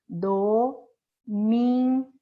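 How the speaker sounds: "Domingo" drawn out as do... min... go; very slow, chanted-out syllables in "Domingo". Shown as a demonstration. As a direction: separate each syllable of "Domingo" with clear pauses. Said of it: "mentalizar e separar ela em sílaba".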